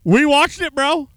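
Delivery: high, very loud holler; shouted support. Real, not acted. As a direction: shout across that you're on his side.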